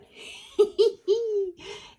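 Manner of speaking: high, loud gleeful tee-hee; gleeful giggle. Real, not acted. Reacting to yet another hand cream, gleeful giggle.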